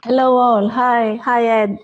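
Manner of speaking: ordinary delivery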